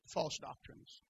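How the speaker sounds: false doctrines whispered. Real, not acted. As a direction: breathe the words out unvoiced. Whispered after climax.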